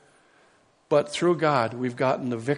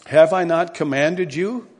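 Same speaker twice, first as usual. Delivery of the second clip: forceful scripture reading; 4 semitones higher, 5 dB louder than usual. Acted. Preacher reading God's words to Joshua to the congregation, booming. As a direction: proclaim God's command with full force.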